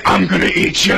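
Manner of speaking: playful monster threat; hammed-up creature voice; pretend monster. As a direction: put on a mock monster voice to chase a child. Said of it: "I'm running after her saying".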